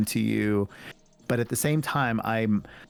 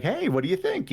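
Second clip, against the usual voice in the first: put-on high, bright call to the kids; playful dad. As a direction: show off the new look to the kids, pitched way up. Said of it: "I'm like".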